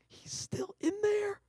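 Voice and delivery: slow, tearful whisper. Retold or imitated. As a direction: barely breathe it out through tears. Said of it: "starts to cry and says".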